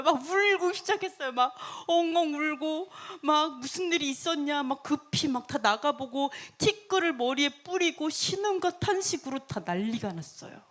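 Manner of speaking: ordinary talk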